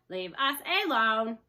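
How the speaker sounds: loud, slow robot howl; a booming robot cry. A narrator's reading. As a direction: bellow it to scare off wolves. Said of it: "she howled".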